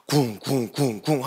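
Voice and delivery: low, spaced-out "kung" thuds; heavy thudding steps. Retold or imitated. Preacher mimics a huge enemy stomping out, low thuds. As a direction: imitate a hulking giant's footfalls, low and soft. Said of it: "진짜 웬 돼지 같은 애 하나가".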